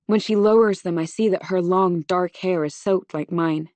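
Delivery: ordinary delivery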